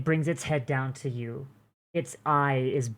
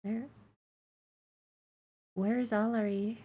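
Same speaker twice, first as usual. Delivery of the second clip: put-on high, soft, faint baby creature; small high creature voice; 8 semitones higher, 4 dB quieter than usual. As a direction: plead quietly like a fragile hatchling. Egg creature asks.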